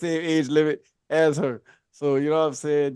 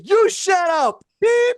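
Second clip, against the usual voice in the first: high, mock-angry retort; heated comeback; 13 semitones higher, much slower than usual. Retelling his comeback to a teammate, heated retort. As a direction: fire it back, pitched way up.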